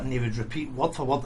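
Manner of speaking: usual speaking voice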